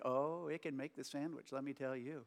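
usual speaking voice

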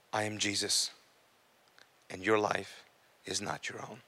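low, soft, measured words with pauses; hushed solemn quote. Retold or imitated. Retelling what Jesus told the speaker, hushed and solemn. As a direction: let each phrase settle quietly and gravely.

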